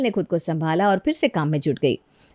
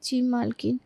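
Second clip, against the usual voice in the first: soft, higher-pitched timid reply; meek submission; 4 semitones higher, 7 dB quieter than usual. Acted. Scolded maid returning to work, meek. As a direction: yield quietly.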